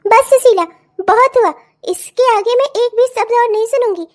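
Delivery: loud furious enough; furious loud stop to the quarrel; angry shout. Acted. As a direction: cut her off hard, shouting. Mother-in-law silences neighbour in quarrel.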